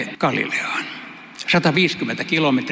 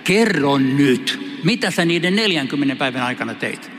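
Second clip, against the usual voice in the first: 1 slow coaxing ask. Retold or imitated. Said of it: "mä kysyn Jeesukselta".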